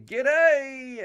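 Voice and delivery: loud, high, drawn-out Aussie holler; called-out greeting. Acted. Host belting out a viewer's greeting, loud high call. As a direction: holler it high and hold the last vowel.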